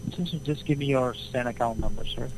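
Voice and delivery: ordinary talk, nothing marked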